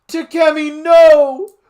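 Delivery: very high, very loud scream; yelled. Acted. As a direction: scream it in horror. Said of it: "I yelled".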